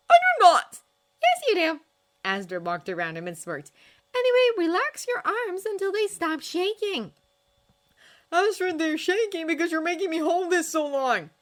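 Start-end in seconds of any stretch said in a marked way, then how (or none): 0.1-1.8 loud, high squawk of denial; indignant protest. Acted. Teasing argument over crying. 4.1-7.1 put-on a higher, louder young woman giving firm instruction; brisk trainer voice, patient but firm; Astrid coaching. A narrator's reading. Astrid resumes teaching. 8.3-11.3 louder, higher complaint; whiny Hiccup. A narrator's reading.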